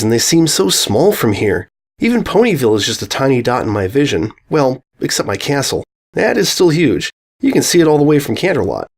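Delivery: plain reading voice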